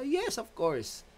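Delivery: high, drawn-out yes; exaggerated, slow of-course reply; playful assent. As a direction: stretch the yes right up high, then drop. Answers the acted student question.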